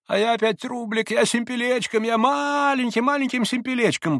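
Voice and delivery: high, cooing, delighted babble; sing-song glee, stretched out up high; blissful babbling. A narrator's reading. Maximov thrilled by his small win, blissful babbling. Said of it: "блаженно бормотал Максимов в страшной радости".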